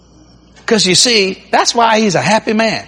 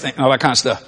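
ordinary talk, nothing marked

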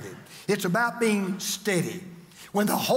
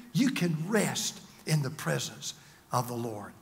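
lowered, soft voice; soothing assurance; 7 semitones lower, 5 dB quieter than usual. Real, not acted. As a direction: sink into a gentle, restful delivery. Preacher comforts, inviting listeners to rest.

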